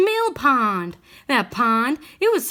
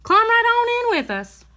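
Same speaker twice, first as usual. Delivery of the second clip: high calling voice over the water; calling back; 9 semitones higher, 3 dB louder than usual. A narrator's reading. Old woman calling to the bear, high and carrying. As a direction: call across to the bear, inviting. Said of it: "Euphonia called back".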